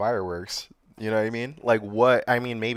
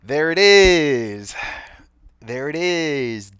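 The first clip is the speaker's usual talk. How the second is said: higher, very slow, stretched-out words; drawn-out reaction; 5 semitones higher, 7 dB louder, much slower than usual. Real, not acted. Host reacting to news that family members were killed, long drawn-out exclamation. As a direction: draw each word right out. Spots the motive in news clip.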